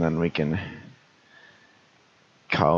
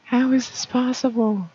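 put-on falsetto girl, a bit louder; high girl voice; 3 dB louder than usual. Trying the girl's line in falsetto. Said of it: "Maybe if I just whisper, I can get it".

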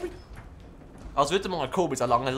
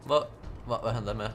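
ordinary delivery

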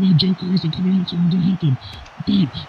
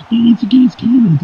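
raised, surprised call; excited; 6 semitones higher, 3 dB louder than usual. Pretend. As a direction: react to the counter. Announcer reacts to a counter.

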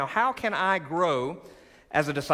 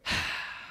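long audible breath out; group exhale; loud exhale; 7 dB quieter than usual. Shown as a demonstration. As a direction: let the breath go with them. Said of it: "Everybody go".

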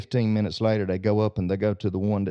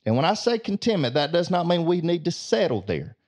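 higher, rising preacher's urging; preaching; 5 semitones higher than usual. Acted. Preacher turning a joke into a lesson for the congregation, urging them on. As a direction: lift the congregation with conviction. Preacher drives home the moral.

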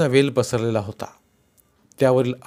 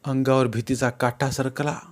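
ordinary delivery

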